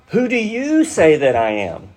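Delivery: "you" lifted high and held, then falling away; stress on YOU. Shown as a demonstration. Preacher voicing Jesus' question to the disciples, stress on "you". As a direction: put the whole question on "you". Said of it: "which means it's emphatic".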